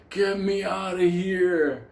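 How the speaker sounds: put-on high, slow, pleading old lady voice; frail old lady plea. Trapped old woman begs the narrator, high and slow. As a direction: beg to be let out, weak and slow.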